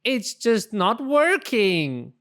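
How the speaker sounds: a storybook wail of giving up; crying out. Acted. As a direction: call it out, fed up and near tears. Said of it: "cried out".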